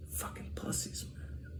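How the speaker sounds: contemptuous whisper under the breath; whispered insult. Real, not acted. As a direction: hiss it privately, dismissive. Muttered insult to close.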